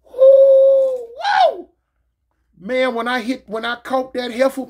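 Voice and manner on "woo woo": loud, high whoop; gleeful high holler; celebratory woo. Acted. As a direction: let out a long high woo. Celebrating the hit in retelling.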